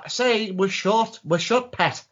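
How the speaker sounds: high, slow, drawn-out repeat; imitating a high-voiced shopkeeper; mock insistence. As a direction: spell it out slowly through the glass. Acted exchange through a shut door.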